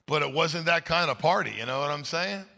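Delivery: ordinary talk, nothing marked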